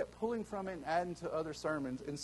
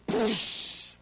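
voiceless, loud poosh of a splash; splash noise; 5 semitones higher, 8 dB louder than usual. Retold or imitated. Friend mimicking the pastor's dunk with a splash noise. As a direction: blow out a sudden splash sound.

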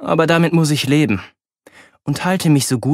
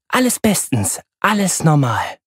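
forced reassurance; 3 semitones higher than usual. A narrator's reading. Mother covering up a mishap.

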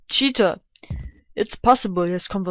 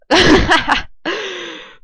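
laugh; 9 semitones higher, 8 dB louder than usual. Real, not acted. Laughs at own fail.

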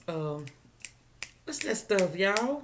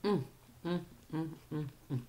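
chewing while humming; closed-mouth chewing sounds; mouth full of corn; 3 semitones lower, 8 dB quieter, faster than usual. Real, not acted. Seen 3: Mouth closed and moving after bites, holding corn cob.

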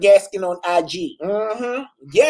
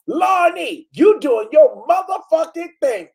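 imitating Tracy; 6 semitones higher than usual. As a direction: belt it out like an overexcited fan. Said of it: "No, this is how she said it".

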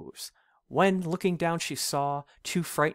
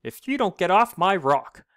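loud, higher threatening cry; goat's cry; 5 semitones higher, 5 dB louder than usual. Acted. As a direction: warn him off the rock. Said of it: "cried a goat".

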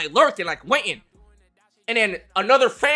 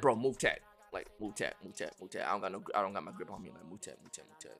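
low, soft hushed panicked whisper; 13 semitones lower, 17 dB quieter than usual. Retold or imitated. Girlfriend on the phone, whispering in panic. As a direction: whisper it fast and urgent.

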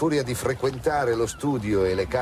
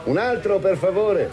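calling to waiter; 11 semitones higher, 6 dB louder than usual. Acted. Ordering from a waiter.

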